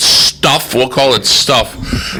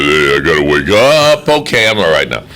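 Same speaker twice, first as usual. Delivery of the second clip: a lightly voiced Rocky, lower; low, mumbled Rocky waking up; 7 semitones lower than usual. Host mimics Rocky talking to himself, low and mumbled. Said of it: "talks to himself like Rocky".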